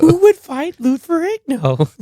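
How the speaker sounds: very high-pitched imitation of incredulous alarm; mock panicked disbelief. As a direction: squeal the question in hammed-up disbelief. Acting the incredulous phone caller.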